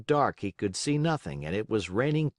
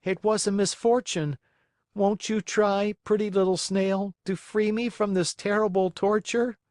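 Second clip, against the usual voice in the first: higher, pleading puppet voice; begging Pinocchio; 10 semitones higher than usual. Acted. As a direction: beg to be let in out of the cold.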